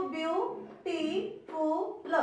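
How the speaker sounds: Beautiful broken into slow syllables with pauses; split into syllables. Shown as a demonstration. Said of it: "जहां आपने pause दिया".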